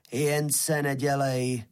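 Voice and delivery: slow, rusty spade voice. A narrator's reading. Spade jeers at the bashful wheelbarrow, slow rusty voice. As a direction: drag it out like rusty old metal. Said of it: "řekl rezatým hlasem Rýč".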